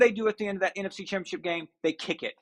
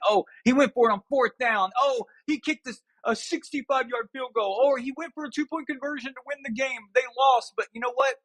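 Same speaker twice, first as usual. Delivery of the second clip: higher-pitched mock admiration; mock gushing; 7 semitones higher than usual. Pundit mocking fans who excuse a bold coach, mock gushing. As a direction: imitate an over-excited fan praising his coach.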